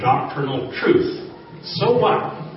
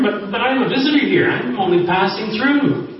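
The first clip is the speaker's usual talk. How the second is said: puzzled; 7 semitones higher than usual. Retold or imitated. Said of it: "asked the puzzled American".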